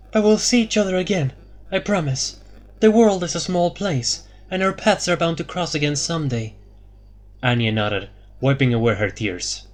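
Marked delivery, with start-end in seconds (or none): 0.1-6.5 Elara says goodbye to a friend; Elara, fond. A narrator's reading.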